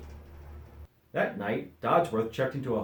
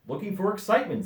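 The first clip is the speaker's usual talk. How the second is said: higher, fast, carefree duck; breezy duck; 9 semitones higher, 4 dB louder, faster than usual. A narrator's reading. Duck answering Dodsworth, higher and fast. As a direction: toss it off lightly. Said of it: "said the duck".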